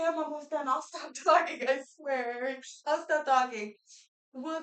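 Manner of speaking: ordinary delivery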